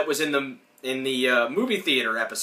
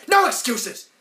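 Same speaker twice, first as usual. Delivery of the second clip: higher, loud cut-off retort; snapping; 10 semitones higher, 4 dB louder, slower than usual. Retold or imitated. Ed interrupting Virginia's excuse, higher snapping retort. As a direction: shut down her excuse.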